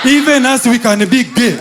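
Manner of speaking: hammed-up indignant shout; shouted protest. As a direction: shout it like a rally cry. Mock protest, shouted punchline.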